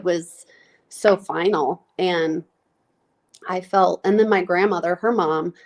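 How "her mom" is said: ordinary talk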